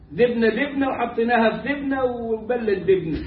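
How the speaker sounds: دبنة said with d in place of the j; mock the dialect that turns ج into د; lightly playing up the d-for-j dialect. Shown as a demonstration. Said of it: "الجيم دال".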